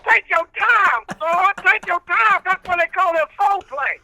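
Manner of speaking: country preacher character. Acted. Old reverend preaching patience to the radio host, in full preacher voice.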